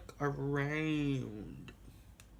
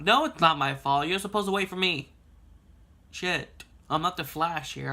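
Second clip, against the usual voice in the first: louder, higher protest against the parents; indignant retort; 3 semitones higher, 4 dB louder than usual. Retold or imitated. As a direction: insist it's their fault, heated. Arguing back at parents.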